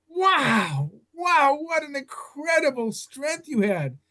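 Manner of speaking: high, slow, awestruck praise; awed wonder. Retold or imitated. Narrator praising the survivor, in high awestruck wonder. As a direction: marvel at his courage.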